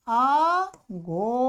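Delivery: आघोटिष्यत in long, stretched syllables; slow model form. Shown as a demonstration. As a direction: draw आघोटिष्यत out slowly, syllable by syllable. Slowly models new form.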